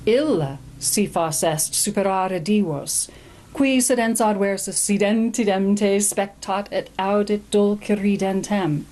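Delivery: slow, measured Latin recitation; poem reading. Shown as a demonstration. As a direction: read the Latin verse aloud with weight. Reads the Latin poem aloud.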